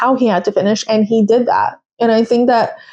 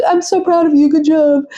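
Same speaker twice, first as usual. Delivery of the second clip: higher, gushing bro-style congrats; frat bro praise; 6 semitones higher than usual. Retold or imitated. Zach congratulating Lando on the radio, hammed-up frat bro. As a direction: pump him up like a proud frat brother. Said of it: "Zach was like".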